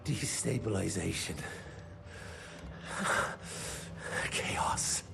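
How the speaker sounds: low voice falling away to a whisper; ominous reveal. Acted. Informant revealing the enemy's endgame, ominous hush. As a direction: let it sink into a hushed, voiceless rasp of air.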